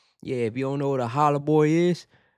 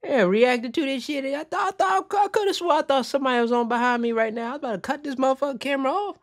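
still rattled; 11 semitones higher than usual. Real, not acted. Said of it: "that really did scare me".

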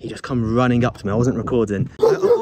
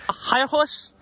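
ordinary talk

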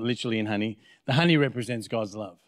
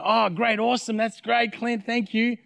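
mock gushing thanks; 10 semitones higher than usual. Imagined grateful caller thanking the pastor, mock gushing thanks. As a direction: gush thanks in a hammed-up high voice.

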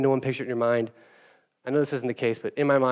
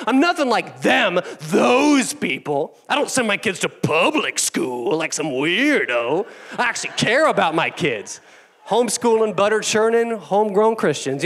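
smug judgmental Pharisee; 4 semitones higher than usual. Acted. Pastor mocking self-righteous homeschoolers with a high sneer. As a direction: sneer down at the weirdos. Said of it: "that's what he does".

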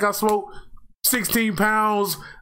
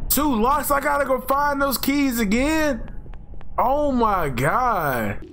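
high, drawn-out disbelief; exasperated; 7 semitones higher, slower than usual. Real, not acted. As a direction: groan at the bad news. Exasperated at more locks.

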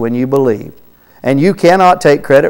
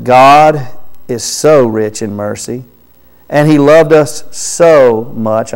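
both "so"s drawn out for emphasis; slow phrasing, leaning on each "so"; stress on SO; 2 semitones lower, slower than usual. Real, not acted. Preacher showing how much God loved us, emphasizing "so". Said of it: "those reasons that I'm emphasizing".

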